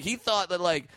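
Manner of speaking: ordinary talk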